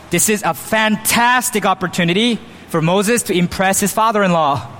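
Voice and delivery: usual speaking voice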